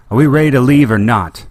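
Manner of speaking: calling from far off, loud; yelled. A narrator's reading. Leia yells to Han from the cockpit, loud call. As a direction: yell it down the corridor. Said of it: "Leia yelled".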